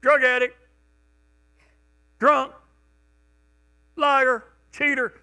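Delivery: loud, high cry of one's own sins; mock announcement. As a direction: call out your shame to society. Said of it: "come to God and say".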